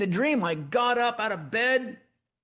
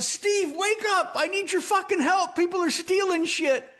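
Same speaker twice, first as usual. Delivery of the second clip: much higher urgent yell across the valley; yelling for help; 12 semitones higher than usual. Retold or imitated. Yelling across the valley to neighbor Steve, a much higher desperate shout. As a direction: call out to Steve far away, desperate. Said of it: "And I yelled".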